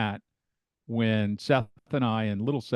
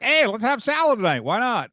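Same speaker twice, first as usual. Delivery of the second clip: higher, louder cheerful suggestion; breezy self-quote; 9 semitones higher, 4 dB louder than usual. Retold or imitated. Home cook talking themselves into salad, breezy and upbeat. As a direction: pitch it up like a sudden bright idea. Acting out own salad decision.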